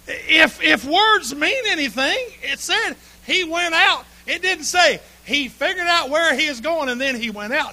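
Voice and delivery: loud, high, slow insistence on the text; forceful preaching. Real, not acted. Preacher to congregation, loud and slow insistence. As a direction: hammer home what it says.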